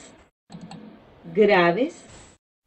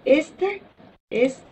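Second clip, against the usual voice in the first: ordinary talk, nothing marked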